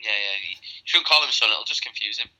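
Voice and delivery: ordinary delivery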